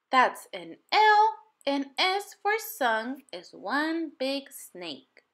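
slow, careful instruction; child teaching. A narrator's reading. Manjeet showing Ling Sung how to write, slow and careful. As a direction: spell it out slowly for him.

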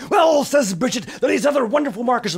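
high, loud, eager burst; a girl bursting with news; excited child. Acted. Bridget excitedly announces markers.

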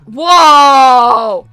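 loud, long, falling shout; startled whoa. Real, not acted. Gamer suddenly launched into a new level, startled. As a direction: bellow a drawn-out reaction.

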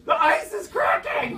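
slow, high, loud shout; alarmed cry. Retold or imitated. Storyteller finds a crack between his legs, alarmed shout. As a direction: cry out as the ice gives way. Ice breaking underfoot.

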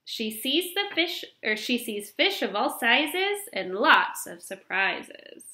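ordinary delivery

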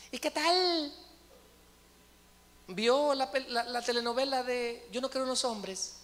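high, gushing small-talk voice; hammed-up friendly chatter, pitched high; mock chitchat. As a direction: greet them like a gossipy friend. Mock friendly chat with a detainee.

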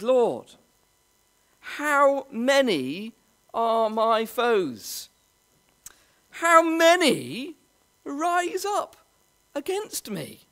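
slow psalm lament. Acted. David cries out in psalm.

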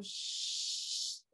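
long unvoiced Shh like hushing a room; sh sound demo. Shown as a demonstration. Teacher models the sh sound after shoe. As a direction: hush out Shh with rounded lips. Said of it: "demonstrating the sh sound".

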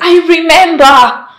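loud, high outburst; emphatic insistence. Acted. Countering a lover's denial of last night, loudly insistent. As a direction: hurl it back forcefully.

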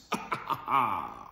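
low mock villain cackle; a deep, hammed-up evil laugh. Butcher gloating over the twins, low evil cackle.

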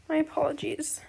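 ordinary delivery

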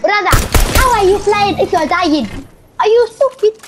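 mock Arabic accent. Boy shows his friend the accent again, hammed up. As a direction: push the fake accent bigger and sillier. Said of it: "Like this".

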